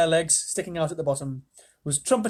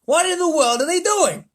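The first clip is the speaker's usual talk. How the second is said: high, loud blustering; shouted; 13 semitones higher, 8 dB louder than usual. Acted. The President shouting at the screens, loud and high. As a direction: bellow in disbelief. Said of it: "shouted the President".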